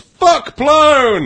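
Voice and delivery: mock outburst, pitched far up; a retold spontaneous yell, hammed up; reading out the listener's frustrated yell at Plone; imitating a yell. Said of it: "I spontaneously yelled".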